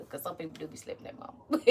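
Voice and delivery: joking whisper aside; a sly whispered aside to the viewers; hushed teasing aside. Real, not acted. Joking aside about slapping mama.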